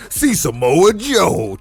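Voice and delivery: slow, booming announcer hype; hammed-up rally promo voice; monster truck ad.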